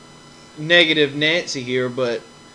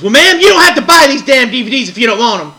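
loud, higher, snapping at the customer; angry shout; 6 semitones higher, 9 dB louder than usual. Retold or imitated. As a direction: bark it back at her like a fed-up clerk. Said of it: "that woman goes".

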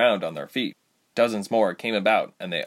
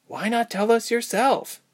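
high-pitched, pressing question; higher girl's voice, pushing back; challenging heroine; 14 semitones higher than usual. A narrator's reading. Ilse challenging the creature guide, high question.

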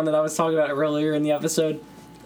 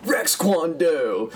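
slow, drawn-out movie-dojo announcement; Rex Kwon Do impression; 4 semitones higher, much slower than usual. Friends joking about the hockey coach, mock Rex Kwon Do intro. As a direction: boom out the dojo name like the Napoleon Dynamite sensei. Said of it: "where he's like".

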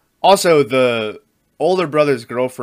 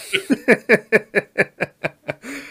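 a burst of high-pitched laughter; 11 semitones higher than usual. Real, not acted. Podcast host laughing at a grim movie quote, high bursting laugh.

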